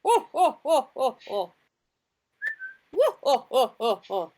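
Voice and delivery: high blustering oh oh cries; hammy mayor exclamations; pompous mayor. Acted. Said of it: "using the mayor's voice".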